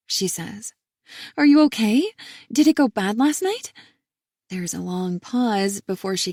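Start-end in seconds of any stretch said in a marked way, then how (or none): 1.4-3.7 worried sister. A narrator's reading.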